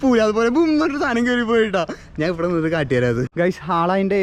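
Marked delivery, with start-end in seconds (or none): 0.0-1.8 high, breathless thrill; excited. Real, not acted. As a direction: gush about the rush. Thrilled after seeing jump.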